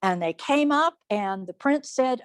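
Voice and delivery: usual speaking voice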